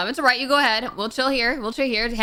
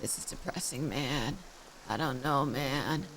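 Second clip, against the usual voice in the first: low, very quiet, slow mope; mock depressed; 6 semitones lower, 11 dB quieter, much slower than usual. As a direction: impersonate a depressed guy, dragging it out softly and low. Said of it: "He sounds depressed. He's like".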